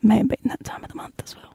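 hushed, quick embarrassed admission; real under-the-breath confession; confiding whisper. Real, not acted. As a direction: whisper it like an awkward secret. Confides something a bit gross.

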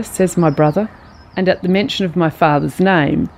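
usual speaking voice

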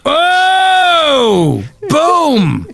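high, loud whoop of delight; excited shout. Real, not acted. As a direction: cheer your own punchline loudly. Cheering his own joke.

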